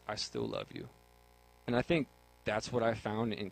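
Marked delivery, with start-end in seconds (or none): 0.1-0.9 much lower, soft, tender divine voice; voice of God. Acted. God reassuring a hidden sinner, deep and soft. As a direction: speak as God, low and gentle. God's voice, opening quote.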